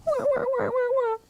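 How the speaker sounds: high mock wailing; play-acted baby "wah" sobs; baby bear crying. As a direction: pretend cub bawling up high. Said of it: "Baby Bear began to cry".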